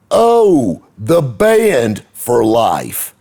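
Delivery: high, loud and very slow, dreamy and drawn out; gushing. Retold or imitated. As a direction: sing out the band like a fond memory. Gushing about the marching band.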